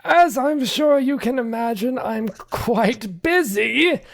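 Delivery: high, loud, stiffly formal butler; a prim servant barely hiding annoyance; slow, high, clipped-free formal retort; flabbergasted butler. Acted. Archimedes retorts curtly.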